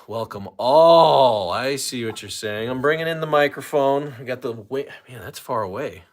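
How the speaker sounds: ordinary talk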